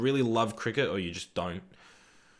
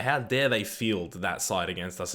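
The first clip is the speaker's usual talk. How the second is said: normal talking voice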